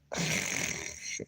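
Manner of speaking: short unvoiced chuckle; a huffed, breathy laugh; quiet scoffing laugh. Real, not acted. Laughs after coin remark.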